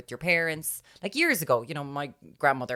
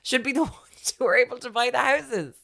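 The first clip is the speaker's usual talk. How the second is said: high, loud emotional rant; wound-up outburst; 13 semitones higher, 7 dB louder than usual. Real, not acted. To her friend about no housing stock, high loud outburst. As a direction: let it burst out, pitched up and loud.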